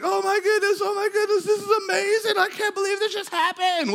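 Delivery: very high, sustained excited squeal; ecstatic imitation. Woman jumping on her healed ankle, ecstatic squealing. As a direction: squeal like someone bouncing on a pogo stick. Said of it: "she starts jumping up and down".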